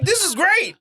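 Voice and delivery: high-pitched mock enthusiasm; hammed-up high excitement; acted delight. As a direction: play delighted approval way up high. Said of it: "And I'm like".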